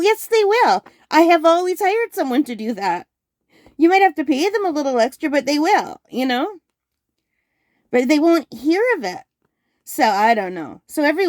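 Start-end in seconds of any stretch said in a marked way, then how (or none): 0.0-9.2 high, emphatic insisting; insistent arguing. Retold or imitated. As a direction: push back hard on mom. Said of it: "I go".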